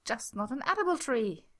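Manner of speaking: high, nervous insistence; hurried high panic; panicked. Acted.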